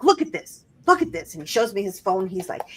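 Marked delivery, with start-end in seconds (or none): 0.0-1.3 higher, insistent mock fury; pissed husband. Husband shoving the text at his wife, irritated insistence. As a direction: jab at the phone, fed up. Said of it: "he's pissed".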